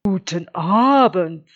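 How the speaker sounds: big, slow bass greeting; deepest bass voice. A narrator's reading. Wolf greeting whoever is in the dark stable, bass voice. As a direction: boom it out slowly as a bass wolf. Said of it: "mit seiner tiefsten Bassstimme".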